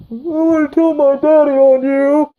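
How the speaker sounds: a spoiled brat tattling; hammed-up crybaby voice; whiny bratty kid. Beaten son whining.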